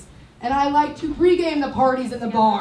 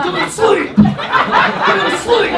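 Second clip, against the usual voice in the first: higher, louder, drawn-out go to sleep; mock hushing shout; 9 semitones higher, 7 dB louder, slower than usual. Tall girl hushing men into her chest, loud mock lullaby. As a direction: shush them loudly like a bouncer mom. Acted quote smothering men.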